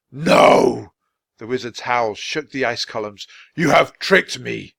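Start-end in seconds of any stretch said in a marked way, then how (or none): 0.1-0.9 a huge roar of No!; the wizard bellows his denial. A narrator's reading. Enraged wizard roaring at the children. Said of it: "the wizard dropped his hand and roared". 3.5-4.7 slow, louder furious wizard; angry accusation. A narrator's reading. Wizard accusing Jack and Annie, slow and furious.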